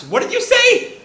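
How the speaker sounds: high, sharp indignant snap; snapping back. Retold or imitated. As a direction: fire it back, pitch shooting way up. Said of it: "Main Yan just snaps".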